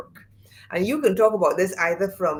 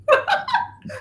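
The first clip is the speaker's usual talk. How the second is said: high delighted laugh. Real, not acted. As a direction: burst of high giggling. Laughing at matching look.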